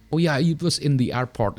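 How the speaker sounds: ordinary delivery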